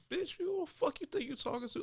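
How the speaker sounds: imagined outburst. Retold or imitated. Imagining telling off a friend in the car, squeaky mock yell. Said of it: "I wanted to say".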